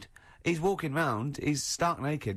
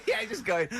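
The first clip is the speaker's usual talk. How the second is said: laughing; 9 semitones higher than usual. Real, not acted. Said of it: "laughing at Carl story".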